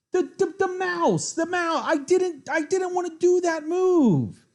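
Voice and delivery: shrieking high and loud in disbelief; panicked screaming. Real, not acted. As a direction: scream at the misclick. Accidental mouse-slip chess blunder.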